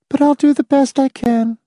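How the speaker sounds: high, thin-toned reply without colour; colorless voice. A narrator's reading. Reluctant guest answering the hostess, colorless. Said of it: "colorless voice answered".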